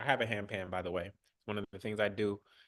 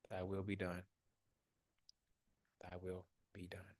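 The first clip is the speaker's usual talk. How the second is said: soft prayer-like chant, fading away; repeated affirmation; 6 dB quieter than usual. Acted. Coach leading the group in the affirmation, growing softer. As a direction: let each repeat sink in quieter.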